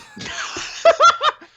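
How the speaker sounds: high loud burst of laughter; big laugh. Real, not acted. Laughing at the Ziggy bit, real high laugh. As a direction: crack up at your own joke.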